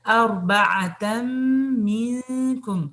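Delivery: منکم with nasal ghunna held long; slow tajweed recitation. Shown as a demonstration. Teacher to class: recite منکم with ghunna. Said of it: "ادغام بغنہ منکم".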